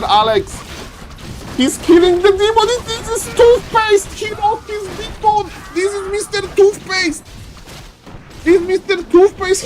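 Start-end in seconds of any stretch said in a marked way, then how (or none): 1.6-9.7 hyped caster squeal; excited high call. Real, not acted. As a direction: call it like the game is on fire. Caster excitedly calling the play.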